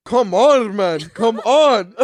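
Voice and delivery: loud, high, drawn-out pleading; hammed-up exasperation, slow and loud; mock exasperated caller. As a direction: imitate a fed-up man wailing in frustration. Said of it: "He does that".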